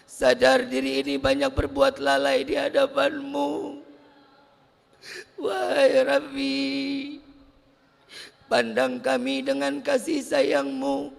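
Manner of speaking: high, loud, slow pleading prayer, half sung; weeping prayer. Acted. Preacher praying for forgiveness for the sinners, tearful pleading. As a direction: plead with God through tears.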